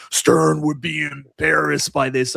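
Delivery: slow, drawn-out imitation of a snob; mock elitist disdain. Pinball elitist sneering at a new machine, mock disdain. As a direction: pronounce judgment slowly, as a parody elitist. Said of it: "pinball elitists or just elitist garbage".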